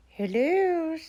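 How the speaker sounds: high, croaky little frog. A narrator's reading. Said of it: "said a croaky voice".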